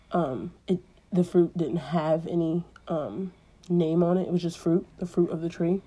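usual speaking voice

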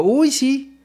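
high mock-sarcastic Uy, sí; joking sarcasm. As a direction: tease him with a high sarcastic Uy. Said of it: "creí que estaba bromeando y le digo".

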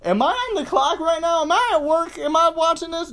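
high, loud disbelief; incredulous. Retold or imitated. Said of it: "and you're like".

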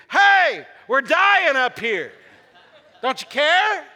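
high, loud, laughing panic; hammed-up yell of a scared sailor; panicked yell. Preacher acting his own panicked cry to a sleeping Jesus, loud and high. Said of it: "What would we do?".

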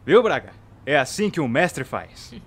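loud, slow, smug boast; gloating. Acted. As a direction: rub it in slowly.

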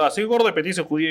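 rattled off quickly, mocking; fast mock self-pity. Comic mocking a self-deprecating joke, fast. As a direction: toss off the self-insult fast.

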